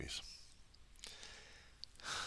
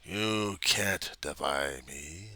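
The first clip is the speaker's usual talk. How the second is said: jeering voice; 11 dB louder, much slower than usual. Retold or imitated. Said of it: "leering, jeering face of the voice saying".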